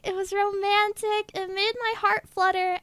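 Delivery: ordinary delivery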